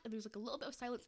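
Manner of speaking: ordinary delivery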